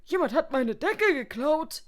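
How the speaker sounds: high, loud indignant cry; outraged complaint. A narrator's reading. Guest phoning reception about a theft, outraged.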